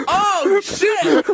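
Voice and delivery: loud, high "Oh, shit!"; mock pained yelp of surprise; imitating a yelp. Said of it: "he was just like".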